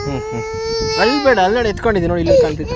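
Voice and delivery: very high, loud sing-song. Real, not acted. Aunt hushing the crying baby in her arms, very high sing-song.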